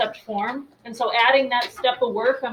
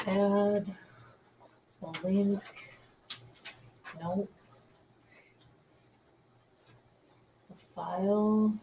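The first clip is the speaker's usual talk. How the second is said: muttering to herself; 4 semitones lower, 11 dB quieter, much slower than usual. Real, not acted. Muttering while posting online.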